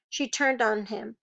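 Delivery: ordinary delivery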